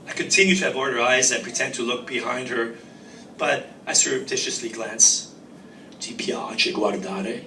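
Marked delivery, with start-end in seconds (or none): 6.0-7.4 low, slow sultry Italian purr; a sultry low voice a la Turner, pitched too low; low sexy voice. Acted. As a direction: drop low and slow to seduce him. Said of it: "The low voice a la Turner is quite sexy, except that it is too low".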